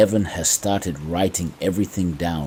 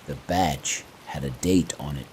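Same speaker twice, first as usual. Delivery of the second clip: plain reading voice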